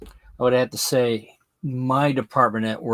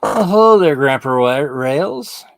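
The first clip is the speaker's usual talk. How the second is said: excited greeting; 3 semitones higher, 8 dB louder than usual. Real, not acted. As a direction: call out a bright welcome. Greeting livestream viewer loudly.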